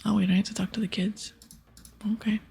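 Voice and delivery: usual speaking voice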